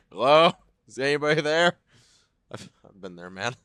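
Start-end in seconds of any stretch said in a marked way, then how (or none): 0.1-1.8 raised, questioning call-out; mock yelling. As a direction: imitate someone yelling down into a toilet. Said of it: "looking into a toilet and just yelling".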